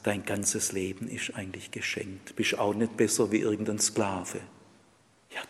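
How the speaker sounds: ordinary talk, nothing marked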